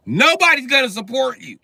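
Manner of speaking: high, loud shout; shouted warning. Real, not acted. Comic warning young people, in a high, loud shout. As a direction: yell the hard truth.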